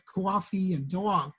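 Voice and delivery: drawn-out Long Island vowels; Long Island accent. Shown as a demonstration. New Yorker showing how home sounds out west, Long Island accent. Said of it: "if you had a Long Island accent".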